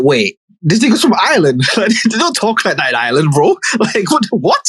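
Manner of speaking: high, laughing disbelief; baffled disbelief. Retold or imitated. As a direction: scoff at it, voice jumping up. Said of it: "I was like".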